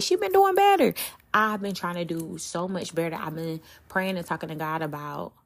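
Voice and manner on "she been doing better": high, louder teasing impression of fans; mock listener voice. Imitating podcast listeners praising her, high and teasing. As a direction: squeal it like a proud fan. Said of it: "I know y'all like".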